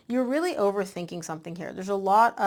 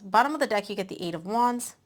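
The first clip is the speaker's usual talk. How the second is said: ordinary talk, nothing marked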